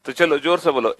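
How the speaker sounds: usual speaking voice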